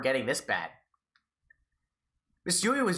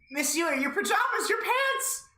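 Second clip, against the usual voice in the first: much higher, rising panic; panicked; slower than usual. Acted. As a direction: squeal it in rising alarm. Satoshi panicking.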